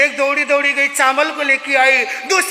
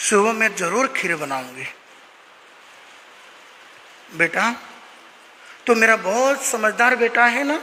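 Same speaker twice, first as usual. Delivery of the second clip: soothing mother; 4 semitones lower than usual. Acted. Mother soothing hungry son at night.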